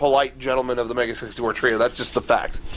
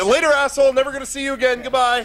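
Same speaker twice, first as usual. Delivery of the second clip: much higher, sing-song taunting goodbye; mock farewell jeer; 11 semitones higher, faster than usual. Friends jeer at the departing elevator guy, mocking. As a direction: jeer him out the door like a kid.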